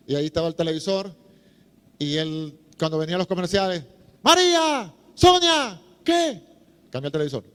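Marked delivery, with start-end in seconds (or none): 4.2-6.4 high, loud yell for the sisters; calling out loud. Retold or imitated. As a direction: holler for them during the commercials. Friend yelling for sisters. (gritando a las hermanas, agudo y fuerte)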